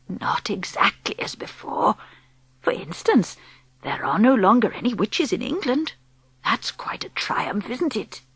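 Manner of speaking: put-on calm whispery old woman explaining; old grandmother. Grandmother speaks.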